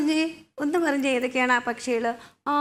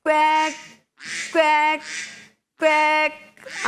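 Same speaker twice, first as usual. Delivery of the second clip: duck quack; slower than usual. Shown as a demonstration. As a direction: imitate a duck for the children. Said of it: "ഒരു പ്രത്യേക ശബ്ദമല്ലേ ഇത്".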